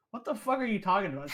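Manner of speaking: loud, high, indignant outburst; loud, high indignant retort to the teasing ex. Retold or imitated. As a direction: throw it back at her in disbelief.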